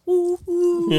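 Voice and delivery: high, loud whooping noise; imitated "woo, woo". Shown as a demonstration. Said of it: "because I'm going".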